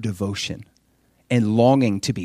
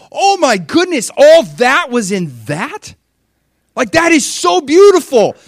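much higher, louder gasping wonder; amazed onlookers; 13 semitones higher, 9 dB louder than usual. Retold or imitated. Everybody seeing the flower blossom, high amazed gasps. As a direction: marvel at the bloom.

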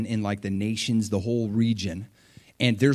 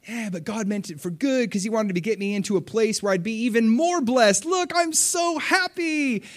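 mock gleeful bragging; 9 semitones higher than usual. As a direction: gush like a smug show-off, stretch the happy end. Mocking a self-centered Joseph.

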